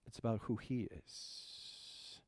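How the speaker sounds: sudden hushed whisper; dramatic whisper. Acted. Pastor pointing church to God, whispered climax. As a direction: drop to a breath for the punchline.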